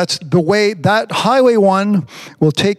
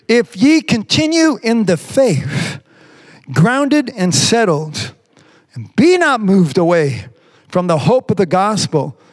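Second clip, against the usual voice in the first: slow, raised solemn reading of the verse; scripture reading; 5 semitones higher than usual. Acted. Preacher reading scripture to the congregation, slow and solemn. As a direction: read the King James words with weight. Reads scripture aloud slowly.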